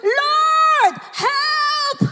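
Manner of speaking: high, drawn-out shout of prayer; desperate cry. Acted. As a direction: cry out to God for rescue. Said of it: "desperado ka na". (desperadong sigaw ng paghingi ng saklolo)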